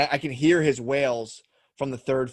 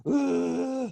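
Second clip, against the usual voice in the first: imitated baby cry; 12 semitones higher, 3 dB louder than usual. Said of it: "while they're just like".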